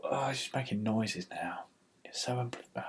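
fed-up whisper. Real, not acted. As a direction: whisper the complaint under your breath. Whispered complaint about the dog.

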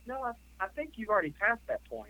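retorting to a rude coworker, pitched high and pointed; pointed comeback. Retold or imitated. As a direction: set them straight with a sly edge.

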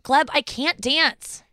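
slow model line. Shown as a demonstration. Dancer gives her partner a line to copy, slow and drawn out. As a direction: say it slowly for someone to copy.

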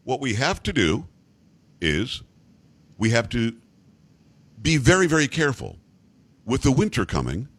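halting politician with big long pauses; Justin Trudeau. Shown as a demonstration. Host giving an example of speech patterns, halting politician impression. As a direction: stop after every few words, as if speaking to a child. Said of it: "he speaks like Obama. And I'll give you an example".

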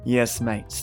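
ordinary delivery

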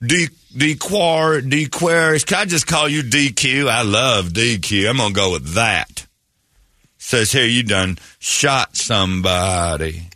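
put-on slow, folksy Southern accent; hillbilly lawyer. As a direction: size up a client in a country lawyer's drawl. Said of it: "You're my lawyer".

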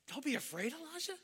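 high-pitched disbelief, climbing at the name; incredulous question. Acted. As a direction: question the master as if he's crazy. Servant questions his master, incredulous.

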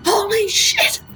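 high, stretched-out cries of alarm; retold panic as the dinghy charges her; panicked cry. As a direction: yelp it as the boat comes at you. Boat aimed at her in water.

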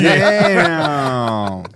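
long, drawn-out falling "damn"; stunned reaction. Retold or imitated. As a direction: stretch it out in mock shock. Acting out his stunned reaction.

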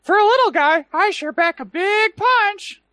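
very high squeaky tiny-guy voice; high-pitched voice. Retold or imitated. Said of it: "this one already has a high-pitched voice".

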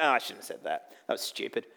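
low, soft mock self-reproach; regretful self-talk. Preacher imitating own second thoughts, low and soft.

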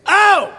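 1 British "Oh!". Retold or imitated. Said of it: "I really hope to hear some".

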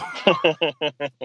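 a high, loud laugh as the story wraps up; real laughter. Real, not acted. Host laughing at the end of the story.

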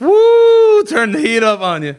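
high, loud whoop; mock hype. Listener reacting to a retold online spat, loud hyped whoop. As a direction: hoot and egg it on, high-pitched.

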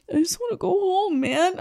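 retold sobbing. Stranded traveler to the person helping him at the airport, mock tearful. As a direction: softly mimic a tearful plea. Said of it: "just sobbing his eyes out".

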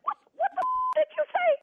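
can't believe what she just heard; outraged disbelief. Real, not acted. Woman accused of bouncing a check, outraged disbelief. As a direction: voice shoots up in outrage.

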